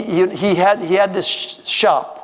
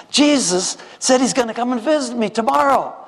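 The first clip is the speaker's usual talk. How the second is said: higher, thrilled and amazed; excited realization; 7 semitones higher than usual. Acted. Shoemaker thrilled that Jesus is coming tomorrow, excited and higher. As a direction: let the joy lift the voice up high.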